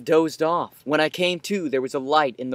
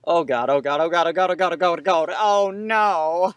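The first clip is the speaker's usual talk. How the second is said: rising panic; 3 semitones higher than usual. Acted.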